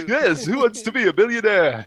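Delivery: hammed-up quiz host impression; loud, high-pitched whooping game show host; Chris Tarrant. Co-hosts goofing as quiz presenters, hammed-up host voice. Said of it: "Chris Tarrant's impression".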